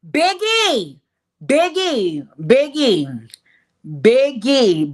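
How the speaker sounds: loud, drawn-out holler of a name; hammed-up repeated yell; calling out. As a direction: holler for someone, each call stretched out.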